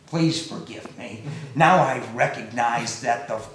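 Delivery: humbled plea. Acted. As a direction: beg forgiveness, head bowed. Humbled king begs forgiveness.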